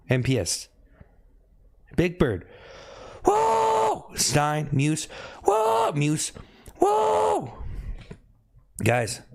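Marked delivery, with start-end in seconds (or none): 3.2-4.1 very high-pitched "whoo"; high whoop. Real, not acted. Cheering out between callouts to players. 5.4-5.9 short, very high "whoo"; piercingly high whoo; high whoop. Real, not acted. Cheering out between callouts to players. 6.8-7.5 very high whoo, falling at the end; long high-pitched whoo; high whoop. Real, not acted. Cheering out between callouts to players.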